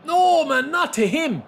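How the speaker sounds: high, loud dismayed yell; alarmed shout. Real, not acted. Alarmed shout at bad pass.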